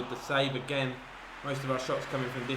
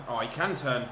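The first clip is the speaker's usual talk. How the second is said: normal talking voice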